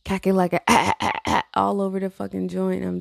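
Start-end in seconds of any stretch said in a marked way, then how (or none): none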